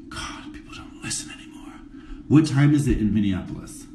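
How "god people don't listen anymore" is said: soft breathy grumble to herself; muttering under her breath that nobody listens; exasperated whisper. Real, not acted. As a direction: whisper it with a sigh, fed up.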